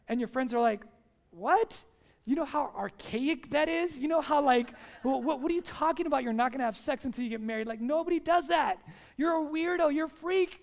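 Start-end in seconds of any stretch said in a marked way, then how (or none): 1.3-10.6 higher, incredulous mockery; mocking friend. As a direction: sneer at the weirdo in disbelief.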